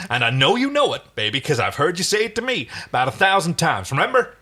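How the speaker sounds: high and loud, rising to "remember"; desperate, cracking. Acted. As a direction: plead and accuse at once. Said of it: "his voice starting to crack".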